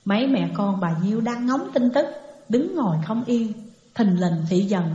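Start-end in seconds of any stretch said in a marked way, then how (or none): none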